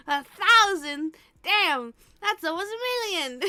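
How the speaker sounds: loud laughing disbelief. Real, not acted. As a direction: laugh it out in mock awe. Joking about follower count.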